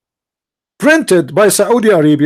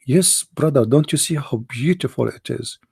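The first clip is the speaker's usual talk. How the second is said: ordinary talk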